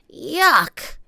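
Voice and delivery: put-on high, loud disgusted kid; girl voice. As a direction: wrinkle your nose, yuck. Annie voiced by narrator.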